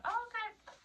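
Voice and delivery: high, soft retold reply; squeaky mock compliance; meek acceptance. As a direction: give in with a tiny high voice.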